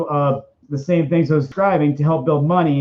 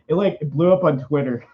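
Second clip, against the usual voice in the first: ordinary talk, nothing marked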